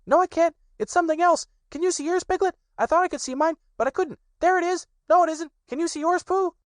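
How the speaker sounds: put-on very high, eager child voice; little Roo chattering. A narrator's reading. Little Roo pesters his friends about their sticks, high and excited. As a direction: tumble the questions out like an impatient little one.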